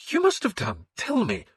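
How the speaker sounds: the priest demanding the nun's name. A narrator's reading. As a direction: press for the name.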